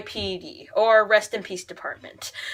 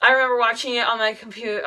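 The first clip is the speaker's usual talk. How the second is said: ordinary delivery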